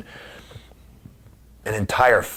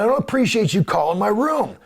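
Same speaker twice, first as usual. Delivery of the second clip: much higher, louder indignant reply; 12 semitones higher, 5 dB louder than usual. Retold or imitated. He snaps back at the caller on the phone, high indignant retort. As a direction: push back hard on him.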